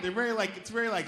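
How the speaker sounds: normal talking voice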